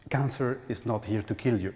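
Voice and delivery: ordinary talk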